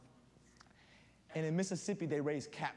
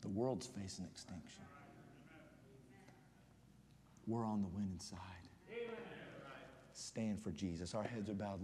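much softer and lower, slowing into prayer; hushed closing to prayer; 7 semitones lower, 9 dB quieter than usual. Acted. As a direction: bring the room down gently into prayer.

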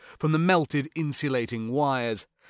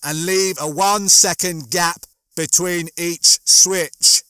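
loud, slow, raised instruction; yelling; 5 semitones higher, 7 dB louder, slower than usual. A narrator's reading. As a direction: yell it slowly and distinctly over the din. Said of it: "Holly was yelling".

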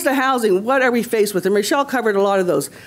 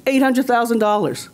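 normal talking voice

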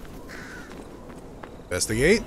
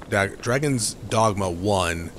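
normal talking voice

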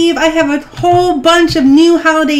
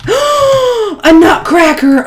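awed gasp; 5 semitones higher, much slower than usual. Real, not acted. Unboxing a rare pet.

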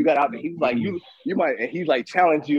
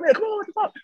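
mildly upset driver. Retold or imitated. Host showing how he himself gets upset driving, high exasperated complaint. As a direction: imitate your own squeaky grumble at traffic. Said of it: "He's heard me get upset and shit".

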